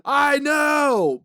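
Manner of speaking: mock shout. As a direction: yell it at a friend.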